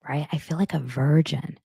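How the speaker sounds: ordinary talk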